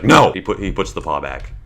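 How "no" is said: sudden loud, higher no; sharp no to the dog. Retold or imitated. Owner stopping puppy from climbing on bed, sharp loud no. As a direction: bark a warning at the puppy. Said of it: "and I'm just like".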